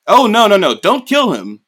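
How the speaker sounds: hammed-up fussing king; mock alarmed king. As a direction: stop him quickly, alarmed. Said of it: "the king is like".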